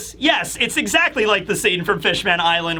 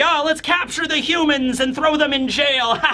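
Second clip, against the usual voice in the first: lightly voiced excited kids, higher pitch; playful giant kids; 7 semitones higher than usual. Kids chasing the humans in play, gleeful mock threat. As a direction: pitch it up like children playing, then laugh.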